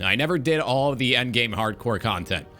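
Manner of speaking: ordinary delivery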